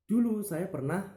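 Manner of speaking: "Dulu" lifted high, rising; storytelling demo. Shown as a demonstration. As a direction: start "Dulu saya pernah" with a high lift. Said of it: "nah, misal seperti itu". ("Dulu" diucapkan tinggi seperti mulai bercerita)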